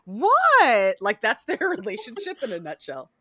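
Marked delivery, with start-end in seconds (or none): 0.0-1.0 louder, rising innocent "What?"; indignant Lestat. Retold or imitated. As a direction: play Lestat baffled and offended. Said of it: "And Lestat's like".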